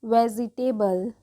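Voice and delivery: Vegetable said slowly as "ve-zi-te-bal"; slow word demo. Shown as a demonstration. Teacher answering how to read it, slow vegetable. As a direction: spell out the sounds of vegetable unhurriedly. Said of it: "क्या पढ़ेंगे".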